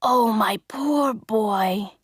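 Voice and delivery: slow tender whisper. Acted. Geppetto pitying his burnt boy, slow whisper. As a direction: breathe it out with pity.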